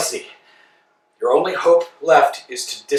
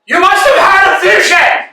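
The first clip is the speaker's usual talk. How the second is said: much higher and louder shout; 13 semitones higher, 6 dB louder than usual. Acted. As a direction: yell it so he hears. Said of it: "No need to shout".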